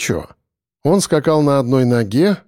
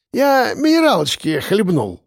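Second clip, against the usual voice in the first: put-on higher-pitched drunk voice; tipsy slurring; 6 semitones higher than usual. A narrator's reading. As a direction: protest with a thick, tangled tongue. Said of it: "язык у него уже заплетался". (пьяно, заплетающимся языком)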